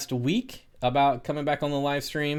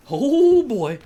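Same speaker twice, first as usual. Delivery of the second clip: excited dog greeting; 12 semitones higher, 8 dB louder than usual. Real, not acted.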